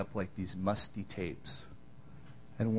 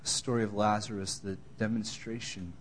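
closing prayer. Acted. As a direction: pray humbly before the church. Pastor closes in prayer.

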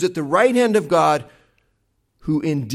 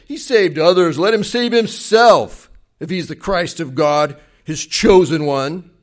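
scoffing; 8 dB louder than usual. Retold or imitated. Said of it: "the ruler scoffed at him".

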